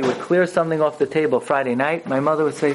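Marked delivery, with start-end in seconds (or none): none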